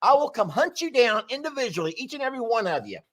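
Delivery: high, mock-menacing warning; playful threat. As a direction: tease them with a fake threat.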